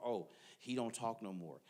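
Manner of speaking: ordinary talk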